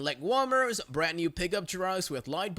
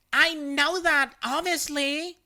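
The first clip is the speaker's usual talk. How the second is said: condescending; 9 semitones higher, 4 dB louder, slower than usual. Retold or imitated. Karen snapping back.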